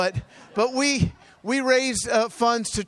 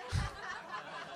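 high laugh; 12 semitones higher, 14 dB quieter than usual. Real, not acted. Laughs at his own failure.

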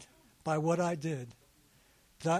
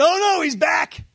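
put-on high, loud whiny teen girl groan; a high, loud mock-whine of dismay; annoyed girl; 10 semitones higher, 9 dB louder than usual. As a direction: groan like a fed-up schoolgirl. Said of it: "the one that said".

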